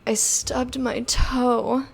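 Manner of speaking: slow, sultry, breathy phone-sex voice; sex line operator voice. Shown as a demonstration. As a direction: purr it like a hotline operator. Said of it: "I like to say stuff in that voice".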